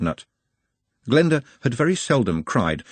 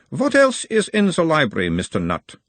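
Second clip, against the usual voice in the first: put-on a mild Uberwaldian accent, the higher voice of a gentle examiner; 7 semitones higher than usual. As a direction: question him in a light Uberwald accent, calming the patient. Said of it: "talking like someone from Uberwald".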